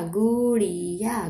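Gudiya stretched slowly; sounding out slowly. Shown as a demonstration. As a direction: draw out Gudiya syllable by syllable.

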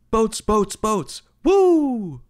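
high, loud hopped-up cheer; excited shouting. Pretend. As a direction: yell it like a pumped-up concert friend. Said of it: "grabbing you by the shoulders and shouting".

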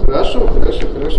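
loud tense shout. Real, not acted. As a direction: yell to back him off. Being threatened by manager.

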